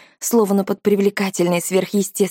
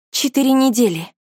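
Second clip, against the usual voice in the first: blurting out; 6 semitones higher than usual. A narrator's reading. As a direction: blurt it out quickly. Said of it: "выпалила я".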